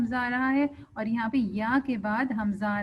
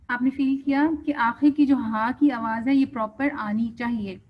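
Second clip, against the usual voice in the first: ordinary talk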